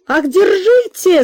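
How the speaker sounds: high, loud, slow cry of alarm; a mother crying out as her daughter's horse bolts; frightened cry. A narrator's reading. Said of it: "закричала Марья Михайловна". (испуганный громкий крик)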